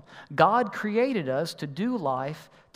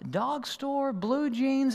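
puzzled thinking; 9 semitones higher, 3 dB quieter, much slower than usual. Retold or imitated. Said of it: "and I thought".

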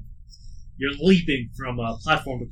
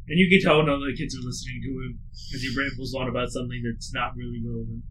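normal talking voice

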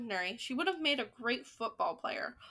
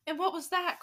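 lightly eager question, higher; crowd crying out; 6 semitones higher than usual. Acted. Witches in the audience crying out to the Grand High Witch, lightly eager. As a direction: ask with a touch of excitement. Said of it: "cried the audience".

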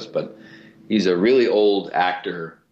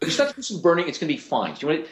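higher-pitched, hurried TV doctor; 8 semitones higher, much faster than usual. Acted. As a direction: toss out quick medical reassurance as a fast-talking TV doctor. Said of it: "he talks really fast".

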